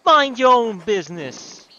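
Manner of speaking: loud, high Brooklyn griping; badly faked Brooklyn shout; bad Brooklyn accent. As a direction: shoo them off in a hammy Brooklyn accent. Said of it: "This is a terrible Brooklyn accent".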